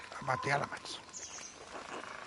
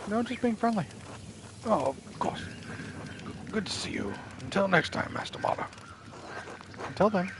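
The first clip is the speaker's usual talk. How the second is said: Smokey voice; 3 semitones higher than usual. Acted. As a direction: play the shy forest charcoal burner, pitched up. Voicing the charcoal burner again.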